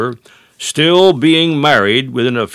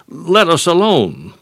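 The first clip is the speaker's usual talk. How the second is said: devil crying out; 5 semitones higher than usual. Retold or imitated. Devil objecting to the word of God, imitated cry. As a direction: imitate the devil's cry, pitched up and falling away. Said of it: "the devil cries out".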